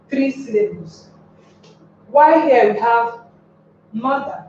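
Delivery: ordinary talk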